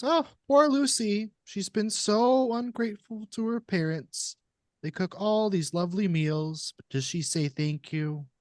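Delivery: put-on high falsetto, man putting on a sweet child-host voice; sing-song fake kindness up high in falsetto; falsetto creepy puppet. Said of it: "an adult man doing a sort of falsetto voice".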